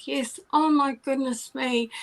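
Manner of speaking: ordinary delivery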